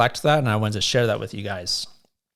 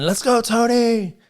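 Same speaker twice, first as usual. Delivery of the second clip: high, drawn-out rallying shout; imitated cheering yell; 11 semitones higher, 4 dB louder than usual. Gym buddy urging on a tiring friend, imitated yell. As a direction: call it out long and high to spur someone on. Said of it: "and he's yelling".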